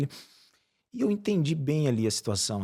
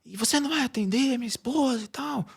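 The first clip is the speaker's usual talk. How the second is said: mock outburst in a raised pitch; irate husband; 10 semitones higher than usual. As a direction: imitate an angry man demanding attention. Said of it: "ele xingou assim e tal".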